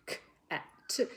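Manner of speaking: K, AT, T said as separate sounds; sounding out. Shown as a demonstration. Teacher showing three sounds in CAT, sounded out one by one. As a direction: break CAT into K, AT, T. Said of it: "only got three sounds in that word".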